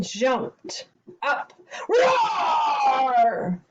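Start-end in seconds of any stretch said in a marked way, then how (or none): 1.9-3.6 loud, higher roaring lion; lion's roar. Acted. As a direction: roar to scare someone. Lion jumps out to scare her.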